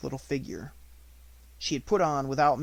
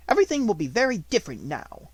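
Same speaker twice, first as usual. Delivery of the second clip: louder, harsh headmistress voice; domineering schoolmistress, louder; stern Miss Minchin; 8 semitones higher, 7 dB louder than usual. A narrator's reading. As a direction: order it coldly.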